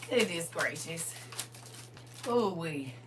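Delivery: ordinary delivery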